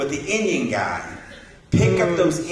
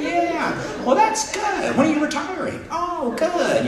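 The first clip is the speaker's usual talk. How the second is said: much higher, chirpy polite small-talk voice; 9 semitones higher than usual. Retold or imitated. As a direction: put on a cheery, proper chit-chat voice.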